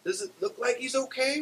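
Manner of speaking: very high, loud parrot screech. A narrator's reading. Parrot answering Beulah about choking Barney, screeching. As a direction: screech it back indignantly. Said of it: "Wilma screeches".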